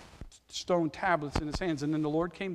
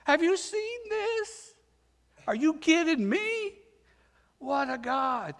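much higher, awestruck disbelief; amazed angels; 11 semitones higher than usual. Retold or imitated. Angels marvelling at God's love, high and amazed. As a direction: gasp it out like astonished angels. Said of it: "The angels are like".

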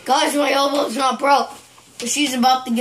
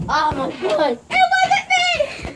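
laughing shout; slower than usual. Pretend. Playful fake panic on camera.

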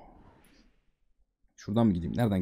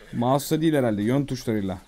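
ordinary delivery

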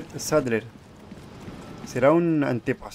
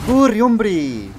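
high, loud, drawn-out urging; exasperated yell; 8 semitones higher, 8 dB louder than usual. Real, not acted. Player urges slow Leon to run, exasperated yell. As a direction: yell it impatiently, falling at the end.

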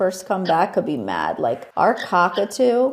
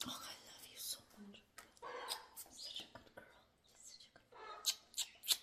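whispered, fast; tender whisper; 25 dB quieter than usual. Real, not acted. Owner whispering praise to her parrot. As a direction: whisper love to the bird.

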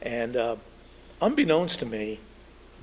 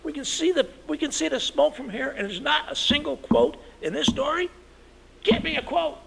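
angry editor shout; 14 semitones higher than usual. Retold or imitated. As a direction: yell at the newsroom for a missing quote. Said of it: "he says".

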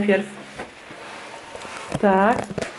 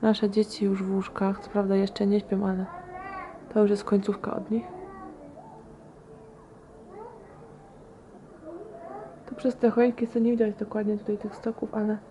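quiet at night; 8 dB quieter than usual. Real, not acted. Evening, children asleep, hushed vlog.

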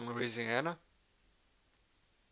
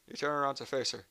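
ordinary talk

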